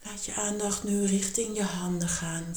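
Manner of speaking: ordinary talk, nothing marked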